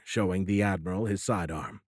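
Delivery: plain reading voice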